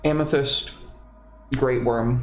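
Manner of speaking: ordinary talk, nothing marked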